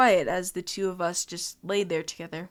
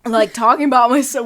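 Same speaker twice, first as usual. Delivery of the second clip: laughing; 4 semitones higher, 13 dB louder than usual. Real, not acted. Breaking into laughter.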